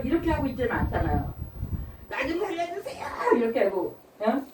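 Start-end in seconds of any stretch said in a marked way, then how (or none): 2.1-3.1 much higher, softer pleading; mock cry for rescue. A person in a fire pleading to be rescued. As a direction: plead to be saved.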